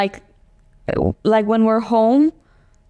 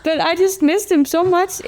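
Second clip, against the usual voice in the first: pitched up high with real feeling; emotional; 8 semitones higher than usual. Real, not acted. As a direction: overcome by missing someone, voice lifted. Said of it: "I'm so emotional today".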